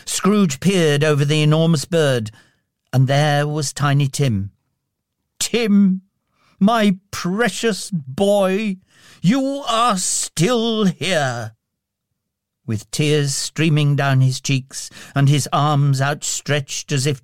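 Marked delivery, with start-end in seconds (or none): 5.4-11.6 tender. A narrator's reading. Scrooge tearful at Tiny Tim.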